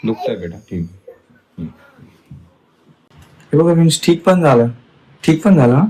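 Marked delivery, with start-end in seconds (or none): 3.5-5.9 excited to viewers. Real, not acted. Tells viewers, surprised.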